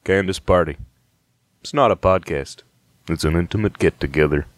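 a cowboy pitching with one leg on a barrel; spicy cowboy. Acted. Cowboy pitches the podcast to the listener, low cowboy voice. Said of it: "and he goes".

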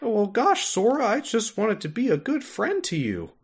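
high, meek mock Jiminy. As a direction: plead like a hurt little cricket. Voicing Jiminy in parody.

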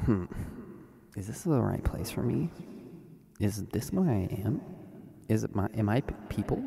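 soft, hesitant inner voice; inner doubt. Retold or imitated. Nervous attendee doubting himself, soft and hesitant. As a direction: wonder quietly to yourself. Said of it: "I was thinking".

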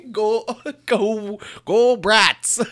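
high Midwestern cheer; mock Wisconsin accent. Friend echoing the Wisconsin cheer, high. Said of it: "I love that accent".

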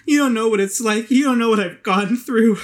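high, loud and wounded; a hurt woman lashing out; distraught drunk woman. Acted. GM voices upset Hazel.

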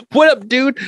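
high, punched casual hello; mock breezy greeting. Mocking Herman's lack of confusion on waking, breezy. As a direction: hail a buddy, voice pitched right up.

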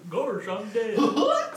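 slow, high hammed-up Goofy dying; overacted death scene. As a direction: drag out a silly cartoon death.

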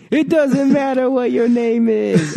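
high, slow wrestler catchphrase; The Rock. Retold or imitated. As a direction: belt out a drawn-out mock put-down. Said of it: "trying to go for a rock".

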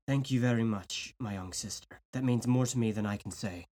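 soft hoarse rasp. Acted. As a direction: rasp it out, moved to tears. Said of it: "he rasped".

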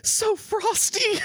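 very high, slow, dreamy voice; dazed bitten man. Acted. GM voicing the bitten man as he turns to frosting. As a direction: drift off in a sugary daze.

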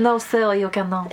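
lightly mocked French accent; a hint of a French waiter refusing. Cohost imitating French restaurant staff, light French accent. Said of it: "They were all French".

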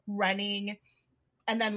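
ordinary talk, nothing marked

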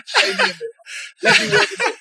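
high squeaky laughing voice; laughing high-pitched jokey voice; laughing falsetto. Pretend.

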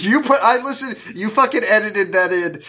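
high, laughing accusation. Real, not acted. Host laughing at co-host's sneaky edit. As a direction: laugh while calling him out.

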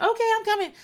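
eager Tori. Retold or imitated. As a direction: chirp it out like a smitten girl. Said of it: "And Tori was like".

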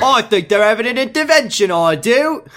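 hammed-up goofy character voice, pitched high; mock impression, high and exaggerated; Toby. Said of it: "I don't know how to do Toby voice anymore".

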